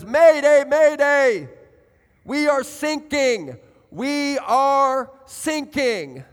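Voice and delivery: loud, high mayday distress call; acted loud shout. Ship captain radios that his ship is sinking, urgent shout. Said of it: "there's a loud shout".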